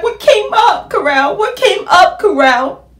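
high, loud, demanding repeat; demanding to know from the boyfriend what came up; indignant demand. Retold or imitated. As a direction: press him hard for an answer. Confronting boyfriend over excuse.

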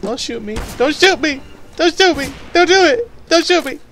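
high, loud pleading yells; mock panicked pleading. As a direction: beg not to be shot, hammed up. Pleading with opponent, repeated.

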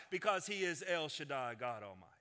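usual speaking voice

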